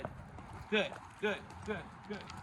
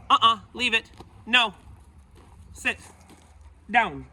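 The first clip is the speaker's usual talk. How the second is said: loud, firm correction to the dog; sharp command; 6 semitones higher, 10 dB louder than usual. Real, not acted. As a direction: snap out the commands to stop her.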